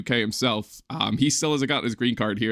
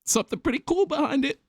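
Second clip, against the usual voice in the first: hiccups; 9 semitones higher than usual. Real, not acted. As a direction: voice jumps up with hiccups. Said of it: "hopefully you can bear the hiccups".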